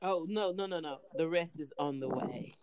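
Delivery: usual speaking voice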